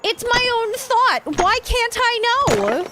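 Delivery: real annoyed wail about the lost thought; exasperated whine. Real, not acted. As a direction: whine it out at your own brain. Frustration at forgetting thought.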